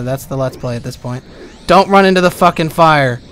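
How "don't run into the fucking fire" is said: loud warning. Real, not acted. Warning himself in game.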